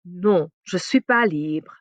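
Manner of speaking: slow, clear "Non, je suis pas libre"; careful French. Shown as a demonstration. Teacher models declining in French, slowly.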